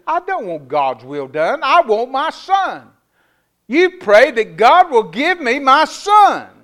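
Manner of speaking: high, loud, slow plea; desperate demand. Retold or imitated. Mother demanding the pastor pray for her son, loud. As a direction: demand it, refusing to yield.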